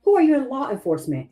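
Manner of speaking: high-pitched, insistent demand; retold firm challenge. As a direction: stand your ground, pitched well up. Retold standoff with the officer.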